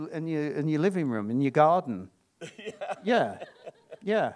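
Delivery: ordinary talk, nothing marked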